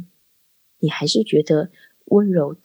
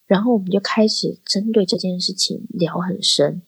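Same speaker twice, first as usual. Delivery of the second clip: normal talking voice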